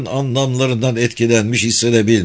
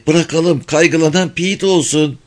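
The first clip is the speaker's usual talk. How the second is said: Millie; 6 semitones higher than usual. A narrator's reading. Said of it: "dedi Millie".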